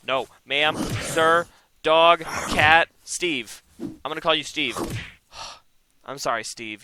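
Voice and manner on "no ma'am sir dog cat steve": louder and louder, trying out names; loud name calling. Real, not acted. Gamer naming his character, shouting out name ideas.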